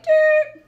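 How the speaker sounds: very high, loud whistle toot; whistle imitation. The ref blowing the whistle after the airball, high loud toot.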